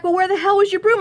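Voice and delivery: high-pitched, incredulous retold question; worked-up mock questioning; exasperated demand. Ryan's retort acted out.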